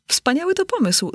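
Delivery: high, bright exclamation of delight; delighted exclaim. A narrator's reading. Alinka exclaiming to the Hatter, delighted and high. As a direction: cry out at a wonderful idea. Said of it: "zawołała Alinka".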